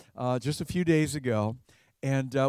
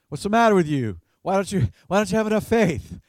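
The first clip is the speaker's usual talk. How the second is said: louder, scolding questions; condemnatory; 4 semitones higher, 3 dB louder than usual. Shown as a demonstration. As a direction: demand it as a reproach. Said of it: "doesn't ask it in a condemnatory fashion".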